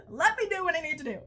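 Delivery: giggly mock defiance; laughing. Real, not acted. Laughing defiance after "shut up.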